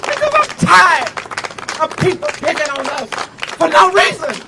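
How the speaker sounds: shouted fiery rally oratory; rally speech. Real, not acted. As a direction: preach it to the crowd, full force.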